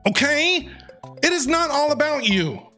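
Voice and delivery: normal talking voice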